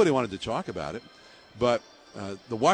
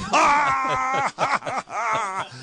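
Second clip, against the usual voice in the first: laughing shout; 14 semitones higher, 7 dB louder than usual. Retold or imitated. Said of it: "to see him slap his thigh".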